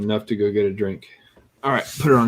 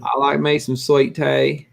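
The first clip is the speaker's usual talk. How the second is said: slow, higher hammed-up Southern drawl; 6 semitones higher, 3 dB louder, slower than usual. Joking with his co-player about sweet tea, slow Southern drawl. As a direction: stretch it out like a country local.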